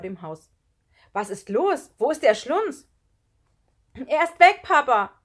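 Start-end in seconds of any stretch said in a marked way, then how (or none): 1.1-2.9 loud, urgent questions climbing high; raised voice demanding to know; alarmed questioning. A narrator's reading. Father's alarmed questions on the steps. 3.9-5.1 very loud, high cry to dad; high outburst to a parent; distressed cry. A narrator's reading. Boy crying out to his father.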